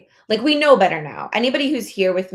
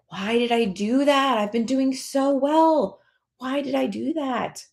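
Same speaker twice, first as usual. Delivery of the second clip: higher, slow mock self-reproach; imitating a dismayed self after a binge; regretful; 5 semitones higher, slower than usual. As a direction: lament it like you blew it. Regretful self after night eating.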